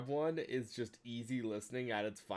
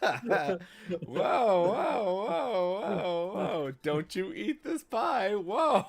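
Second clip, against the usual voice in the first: much higher, louder, slow then whoa cries; hammed-up meme quote; 7 semitones higher, 5 dB louder, much slower than usual. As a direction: ham up the pie line. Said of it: "quoting the meme".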